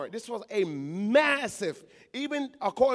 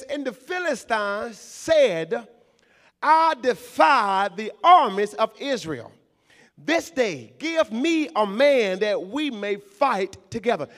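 loud, slow pulpit reading of the verse; preaching scripture; 5 dB louder than usual. Acted. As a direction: proclaim it slowly to the church.

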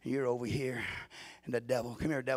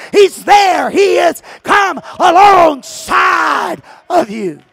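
preaching at full force; 11 semitones higher, 21 dB louder than usual. Acted. Preacher shouting to the church at full force. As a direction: thunder it to the congregation.

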